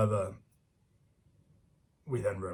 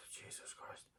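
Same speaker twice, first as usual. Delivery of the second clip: a sighed, breathy whisper of disbelief; exasperated whisper; 24 dB quieter, slower than usual. Real, not acted. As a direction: mutter it under your breath, fed up. Exasperated after the quote.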